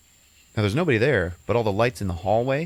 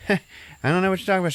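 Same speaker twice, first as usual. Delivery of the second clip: nervous laughing denial; 11 semitones higher, 3 dB louder, much faster than usual. Retold or imitated. Mom nervously denies, laughs.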